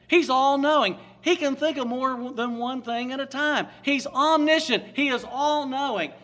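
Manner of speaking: loud, emphatic pulpit proclamation; preaching at full force. Acted. Preacher extolling God's power to the congregation, booming. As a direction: proclaim it to the back row.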